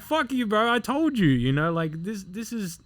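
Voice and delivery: high-pitched, indignant retold rant; angry protest. Trainee to officer after near crash, angry. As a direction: re-enact telling off a superior.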